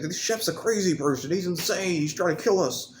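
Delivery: hammed-up panic; mock panicked guests. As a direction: squeal the warning like a hammy extra. Mocking panicked dinner guests.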